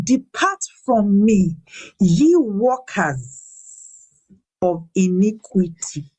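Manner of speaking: slow, weighty words of judgment; heavy, drawn-out scripture line; solemn slow quote. Acted. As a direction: draw each word out like a verdict. Quoting the Lord's judgment.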